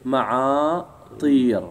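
معاطير stretched slowly, long vowels held; slow model plural. Shown as a demonstration. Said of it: "إيش نقول؟". (نطق معاطير ببطء وتمهّل)

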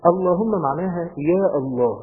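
normal talking voice

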